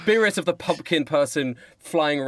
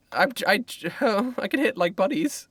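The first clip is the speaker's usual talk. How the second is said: high, stammering scout voice; flustered scout; 8 semitones higher than usual. Acted. Scout answers the archery lecture, high flustered stammer. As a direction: stumble over it in a high voice.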